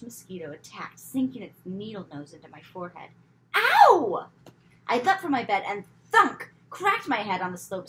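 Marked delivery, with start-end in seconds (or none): 3.5-4.3 high loud yelp of pain; sharp ow. A narrator's reading. Girl bitten by the mosquito, sharp high yelp. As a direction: yelp as if stung.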